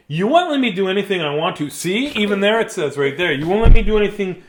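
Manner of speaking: put-on loud, high kid tantrum; mad protest. Mad child complaining nobody lets them, loud protest.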